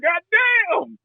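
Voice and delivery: high-pitched mock outburst; cursing. Baby Jesus curses his own dad, mock exasperated. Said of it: "cursing his own dad already".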